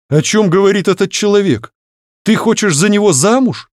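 high-pitched, appalled questioning; a touch of fatherly horror; horrified. A narrator's reading. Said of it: "в ужасе спросил он". (в ужасе)

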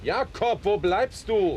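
shouted from afar, voice leaping high; calling out. Acted. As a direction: bellow his servant's name up the stairs. Master calls servant from upstairs. (laut nach dem Diener rufen)

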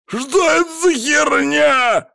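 very high, drawn-out shout; pained yell. A narrator's reading. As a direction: scream as you're bitten. Thug bitten by dog.

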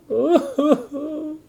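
high, hammed-up wailing sob; a cartoon giant bawling, pitched high; mock wailing sob. The giant weeping over his locked fridge, hammed-up high wail. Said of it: "weeping, crying".

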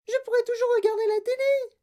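put-on extremely high miniature boy; very high, tiny piping voice; tiny mouse-squeak voice. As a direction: squeak it out like someone an inch tall. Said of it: "la petite voix de Mike Tivy".

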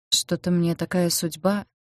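ordinary delivery, nothing marked

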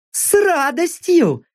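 high, slow smirking woman's voice. A narrator's reading. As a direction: grin through the words, cocky. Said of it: "усмехнулась сквозь забрало наёмница". (с усмешкой, высоким голосом)

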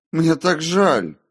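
slow, loud, high wail of apology; drawn-out, high cry of regret; desperate remorse. A narrator's reading. Remorse repeated more desperately.

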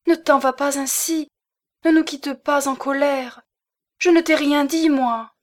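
supplique aiguë et forte, au bord des larmes; implore-le de rester, à pleine voix; cri suppliant. A narrator's reading. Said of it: "À ce cri sublime". (l'épouse supplie son mari en larmes de ne pas partir)

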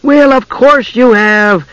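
high, drawn-out amazement; amazed. A narrator's reading. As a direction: stretch it out, astonished he didn't know. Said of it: "amazed that the dwarf".

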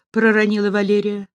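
ordinary delivery, nothing marked

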